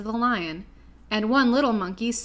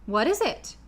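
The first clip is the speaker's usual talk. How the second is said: surprised; 3 semitones higher than usual. A narrator's reading. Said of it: "answered Dorothy in surprise".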